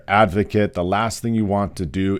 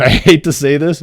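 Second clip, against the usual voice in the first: higher, louder, through a laugh; laughing admission; 9 semitones higher, 9 dB louder than usual. Real, not acted. Nurse admitting to viewers an unpopular tip, laughing. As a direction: laugh as you confess it.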